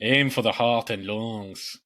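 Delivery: slow, mock South African accent. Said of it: "[name] is South African now".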